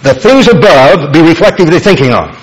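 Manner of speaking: "things above" pushed high and loud; front-load "things above" with force, then let the rest fall; stress on THINGS ABOVE. Shown as a demonstration. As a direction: hit "things above" hard as the emphatic opening. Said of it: "here's the way the Greek order is for, for emphasis".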